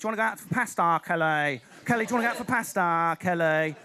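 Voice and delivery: Essex accent with stretched vowels. Shown as a demonstration. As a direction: draw out the vowels in mock Essex. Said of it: "the more pikey your accent is".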